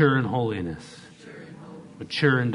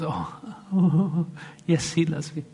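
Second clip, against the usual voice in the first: higher-pitched mock swooning wife; delighted wife; 5 semitones higher, much slower than usual. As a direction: gush like a smitten wife, laughing. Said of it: "She goes".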